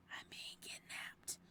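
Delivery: whispered, slow and barely audible. Acted. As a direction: whisper so he can't hear. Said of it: "I whisper to myself".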